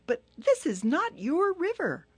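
high, apologetic little turtle; Turtle's voice. Acted. Small turtle excusing herself to Hippo, high and polite.

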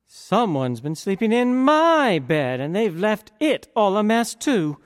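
an indignant mother bear, shocked; high, put-out mama bear. A narrator's reading. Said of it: "And the mama bear".

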